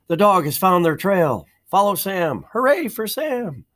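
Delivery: cheering, pitch climbing high; excited crowd shout. Acted. Townspeople calling out, finding the trail.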